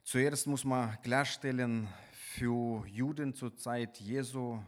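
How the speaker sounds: ordinary talk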